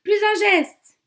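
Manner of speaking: a cornered cop screaming at the killer, loud and high; terrified yell. Acted. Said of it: "je hurle de toutes mes forces".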